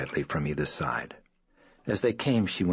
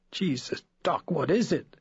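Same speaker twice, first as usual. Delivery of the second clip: higher, rising panic; panicked plea; 11 semitones higher than usual. A narrator's reading. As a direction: beg the doctor in alarm. Frightened mother pleading.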